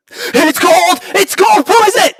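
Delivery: real excited yelp at a gold pull; excited shout. Real, not acted. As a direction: shout it like you just won. Summon hits a gold shard.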